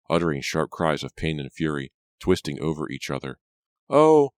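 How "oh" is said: high, loud straining oh; girl groaning as she lunges for the gun; groan of effort. A narrator's reading. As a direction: grunt it mid-struggle. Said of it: "Pam groaned".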